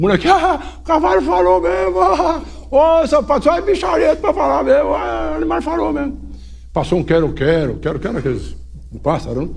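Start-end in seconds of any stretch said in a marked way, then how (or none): 0.3-6.2 menino maravilhado. Retold or imitated. Menino maravilhado com o cavalo.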